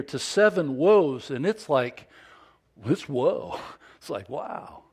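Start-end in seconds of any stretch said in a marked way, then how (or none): none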